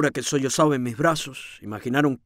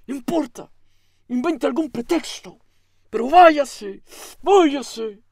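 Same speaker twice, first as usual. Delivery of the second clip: a light, higher-voiced hint of the man ordering her out; urging him out; 8 semitones higher than usual. A narrator's reading. The man telling Rosaura to leave, lightly voiced and insistent. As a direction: push the words out, insisting she leave. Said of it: "Y, y él, otra vez".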